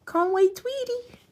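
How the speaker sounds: imitating her younger self; childish mispronunciation. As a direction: chirp it like a little kid. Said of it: "I used to call him Tweety".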